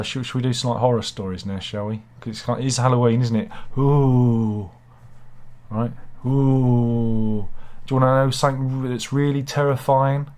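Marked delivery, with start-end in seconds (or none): 3.8-4.7 drawn-out ghostly ooh, louder; a mock haunted ooh to set a horror mood; hammed-up ghost moan; spooky ooh. Spooky horror story intro. 6.2-7.5 drawn-out ghostly ooh, louder; a mock haunted ooh to set a horror mood; hammed-up ghost moan; spooky ooh. Spooky horror story intro.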